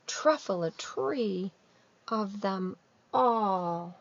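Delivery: very slow, hushed storytelling of the last tree; slow dramatic reading. A narrator's reading. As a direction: let each word fall heavily. Climax narration read slowly.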